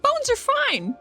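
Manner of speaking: normal talking voice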